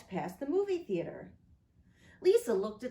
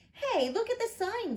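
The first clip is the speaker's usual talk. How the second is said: put-on excited child; 13 semitones higher, 3 dB louder than usual. Lisa spots the sign.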